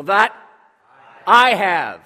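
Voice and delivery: loud, emphatic "I have", with a pause before it; confident, forceful call-out for repeating. Shown as a demonstration. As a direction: say it loud and make it personal. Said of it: "Sound confident".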